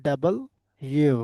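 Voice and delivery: ডাবল with a rise, then separate ইউ; correct W name. Shown as a demonstration. Teacher checking the class, ডাবল ইউ said in full. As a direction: break ডাবল ইউ into two clear words. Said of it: "আমাদের কি বলতে হবে?".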